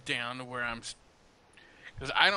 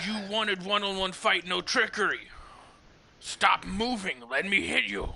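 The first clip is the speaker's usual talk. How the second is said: higher-pitched in-character demand; exasperated challenge; 6 semitones higher, 3 dB louder than usual. Acted. Fighter to dodging foe in a duel, exasperated challenge. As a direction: demand that your opponent stand still.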